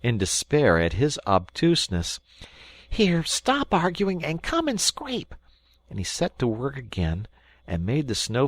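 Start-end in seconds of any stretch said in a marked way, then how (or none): 2.9-5.3 bossy Rat. Acted.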